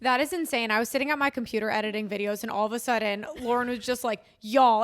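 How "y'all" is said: one loud, urgent call; all-caps shout. Retold or imitated. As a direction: punch it out like an all-caps text. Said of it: "in all caps".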